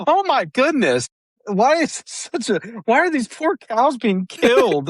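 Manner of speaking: put-on high, startled old lady voice; shocked grandmother. Grandmother watching cows get shot, high and shocked. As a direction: gasp it out like a horrified grandma. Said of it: "She goes".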